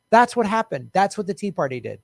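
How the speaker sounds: usual speaking voice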